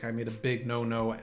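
ordinary talk

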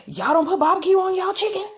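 mock his excited question; imitating TikTok guy. Vlogger imitates TikTok guy mocking no-barbecue chicken, fast and high. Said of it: "he said something to the extent of".

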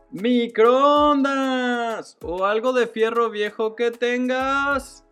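high, drawn-out street vendor call over a crackly loudspeaker; crackly looped vendor recording. Retold or imitated. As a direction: call it out slow like a looped scrap-buyer megaphone. Scrap buyer street call inserted.

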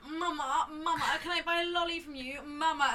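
high, loud, laughing whine; laughing high mock plea to the toilet attendant; whiny drunk girl. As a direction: beg like a tipsy girl calling for mama. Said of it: "this other girl behind us was going".

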